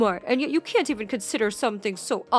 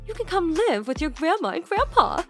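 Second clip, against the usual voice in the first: coaxing; 3 semitones higher, 5 dB louder than usual. A narrator's reading. Grandmother coaxing grandson to leave.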